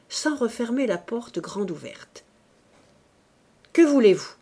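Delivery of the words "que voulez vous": louder, sharper repeat; repeated angry demand. A narrator's reading. Priest repeating his demand to the woman, angry. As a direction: hit the question again, impatient. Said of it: "répéta-t-il".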